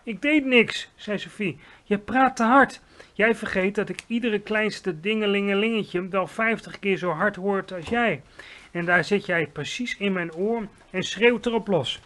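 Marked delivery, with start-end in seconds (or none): 0.0-0.9 high, loud defence; startled protest. A narrator's reading. Sophie answering the yelping giant, startled protest. 1.8-12.0 raised, loud voice of a pained giant; complaining giant. A narrator's reading. Giant scolding the girl sitting in his ear, complaining.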